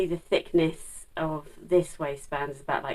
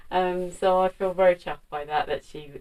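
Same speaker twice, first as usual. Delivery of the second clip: normal talking voice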